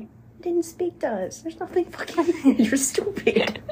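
high-pitched, laughing put-down; mock him through giggles; laughing mockery. Mocking ex at the graveyard.

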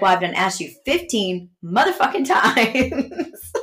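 half-laughing, fed-up mom venting; laughing mock scold.

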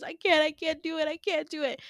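high, pleading despair; mock-tearful high plea; acted crying. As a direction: beg helplessly, on the edge of tears. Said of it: "he's just crying and".

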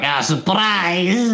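put-on high, drawn-out Swedish-accented burst. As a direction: pop out as a surprise Swedish accent. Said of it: "my Swedish accent, it's like".

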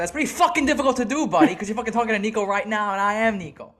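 high, fast, heated retelling of an outburst; fuming retort. Retold or imitated. Customer tearing into the confused delivery driver, fuming. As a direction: rattle it off in rising outrage, pitched well up.

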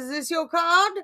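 high, loud showman voice; hammy magician's reveal; mock magician.

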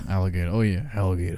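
low Louisiana-style ALLIGATOR with soft R; Louisiana accent. Retold or imitated. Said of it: "people with a Louisiana accent say".

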